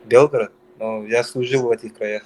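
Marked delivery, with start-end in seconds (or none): none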